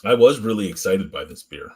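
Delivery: ordinary delivery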